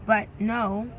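ordinary talk, nothing marked

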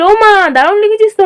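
high-pitched, enthusiastic approval; delighted auntie's exclamation; encouraging praise. Acted. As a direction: cheer on a budding writer. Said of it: "বেশ উৎসাহ দিয়ে বলল".

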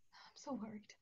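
soft worried whisper; anxious whisper. Acted. Helena alone, fretting about children: hushed worried whisper. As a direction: breathe it out, barely voiced.

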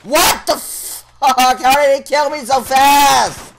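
loud, shouting at the game; raging gamer. Real, not acted. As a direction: yell in outraged disbelief. Raging after dying.

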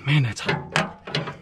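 slow whispered grumble; muttered under breath. Real, not acted. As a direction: hiss it to yourself. Just burned on hot wire.

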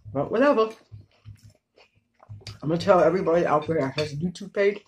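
talking around a mouthful of noodles; chewing between words; mouth full, chewing. Real, not acted.

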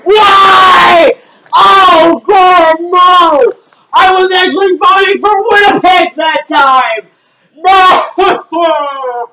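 high wailing shout; anguished outburst. Real, not acted. Fan hearing Toronto won, anguished outburst. Team loses out in lottery.

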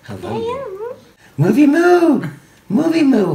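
high, loud calling of the pet; excited pet call. Real, not acted. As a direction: call the dog in a sing-song. Calling the puppy by name.

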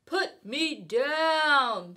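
high, slow, protesting fish; a fish pleading to be let down; upset fish. Acted. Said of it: "said the fish".

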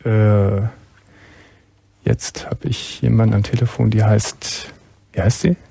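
usual speaking voice

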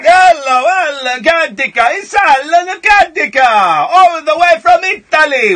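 loud, high, sing-out mock Italian welcome; hammed-up Italian greeting. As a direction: hail the caller like a hammy Italian showman. Mock-announces caller as coming from Italy.

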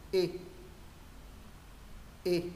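short i sound with jaw dropped; loose, short Y vowel; short lax Y. Shown as a demonstration. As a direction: drop the jaw for a brief Y. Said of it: "chữ Y thứ nhất hạ cầm xuống".

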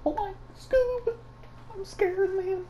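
very high, thin cartoon panic; hammed-up frightened squeak; mock-scared cartoon voice. Plays frightened friend of Scoob.

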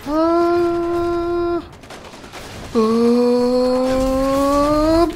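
high loud yelps of pain, ah; pained cry. Acted. Player's character gets hit, high loud pained yelp.

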